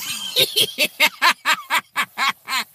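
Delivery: long high burst of laughter; real helpless laugh. Real, not acted. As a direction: crack up at your own story. Cracks up at his own begging.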